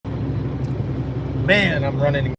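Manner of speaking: talking while brushing teeth; words through a toothbrush. Real, not acted. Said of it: "I'm just brushing my teeth".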